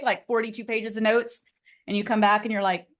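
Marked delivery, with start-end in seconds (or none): none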